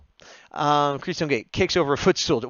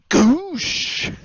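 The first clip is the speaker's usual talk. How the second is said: high loud goofy whoosh noise; rocket whoosh; 8 dB louder than usual. Pretend. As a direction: blast it like a fart rocket. Fart rocket sound effect.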